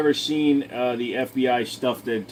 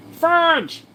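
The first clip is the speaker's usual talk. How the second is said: "Fudge" squealed much higher and louder, in a strained voice; pained squeal; 5 dB louder than usual. Real, not acted. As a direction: shriek "Fudge" as the pain bites. Pain from pulling ingrown hair.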